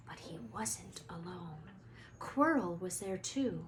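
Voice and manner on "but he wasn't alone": tense whisper, much softer. A narrator's reading. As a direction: hush it with suspense. Suspense in narration.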